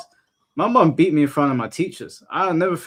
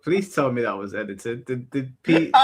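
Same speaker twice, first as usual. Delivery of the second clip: ordinary talk, nothing marked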